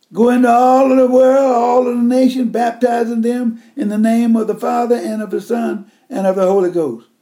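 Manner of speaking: loud, high, ringing scripture proclamation; preaching at full force. Acted. Preacher booming the great commission to the congregation, full force. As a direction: proclaim it like a revival call.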